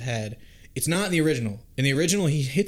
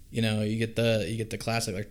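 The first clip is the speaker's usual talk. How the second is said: usual speaking voice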